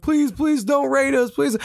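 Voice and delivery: high, slow, mock-whiny kid's plea; crying kids begging. Kids who trash-talked now begging the raiders, mock whimpering. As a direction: beg like a bawling little kid, hammed up. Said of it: "all these little kids start crying".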